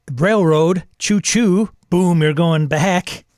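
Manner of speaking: loud, high mock train call, slow at the end; choo-choo with loud mock glee. Real, not acted. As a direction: sneer at being railroaded. Mocking railroaded game.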